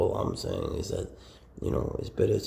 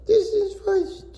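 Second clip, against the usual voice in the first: much higher, slow cutesy voice; squeaky pet voice; 11 dB louder, slower than usual. Pretend. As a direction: squeal the name like to a puppy. Squeaky pet-talk repetition.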